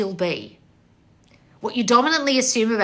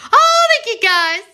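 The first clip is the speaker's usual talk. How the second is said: high, loud drawn-out squeal of thanks; delighted squeal to the fans; excited thanks; 13 semitones higher, 8 dB louder, slower than usual. Real, not acted.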